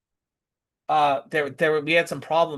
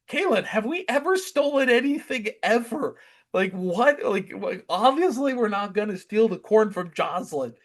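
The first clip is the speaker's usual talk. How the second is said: high-pitched exasperated protest; hammed-up, high disbelief; mock incredulity; 9 semitones higher, 4 dB louder than usual. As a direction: act out an indignant parent defending themselves. Acted incredulous retort to accusing child.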